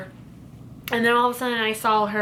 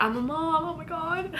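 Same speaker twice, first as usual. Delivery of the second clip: higher and much softer, near tears; overcome with emotion; 7 semitones higher, 6 dB quieter than usual. Real, not acted. Mom reliving first meeting her baby, higher, soft, teary. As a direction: let the words catch in your throat. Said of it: "I am so emotional".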